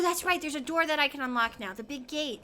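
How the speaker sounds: ordinary delivery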